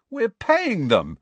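slow, high indignant protest. A narrator's reading. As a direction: sound offended and put out. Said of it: "said Fred indignantly".

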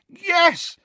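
loud. A narrator's reading. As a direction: call it out with joy. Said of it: "said Harry loudly".

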